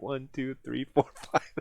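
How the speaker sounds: lightly voiced kid counting; counting like a child. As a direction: count on your fingers like a little kid. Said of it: "I use my fingers like a child".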